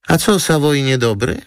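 high, puzzled question; high-voiced model, taken aback; surprised. A narrator's reading. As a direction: query it in surprise, pitched right up. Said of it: "zdziwiła się Antonina".

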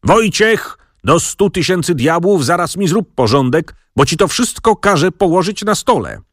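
high call down the corridor. Acted. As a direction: shout for the stable hand from afar. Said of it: "z korytarza zawołał".

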